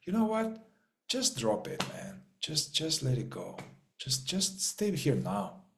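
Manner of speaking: soft, gentle voice of a calm thought; soft inner voice. Retold or imitated. The inner thought soothing the anxious mind, soft and gentle. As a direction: hush yourself kindly, let it go. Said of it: "I have a thought that says".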